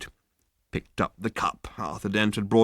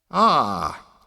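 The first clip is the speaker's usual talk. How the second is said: snapped; 4 semitones higher, 5 dB louder than usual. A narrator's reading. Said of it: "he snapped".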